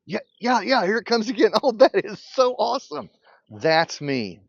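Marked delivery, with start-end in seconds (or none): 0.1-3.1 high, loud, delighted; thrilled shout. Retold or imitated. Friend cheering that he finally sees it, loud and high. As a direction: whoop with joy at finally seeing.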